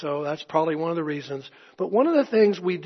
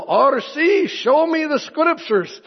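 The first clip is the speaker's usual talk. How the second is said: put-on high, slow lilting Irish or Welsh accent, louder; Alistair Begg accent; 10 semitones higher, 6 dB louder, slower than usual. Pastor retelling Begg pressing RC in a lilting accent. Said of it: "Alistair Begg would go".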